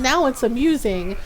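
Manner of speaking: ordinary talk, nothing marked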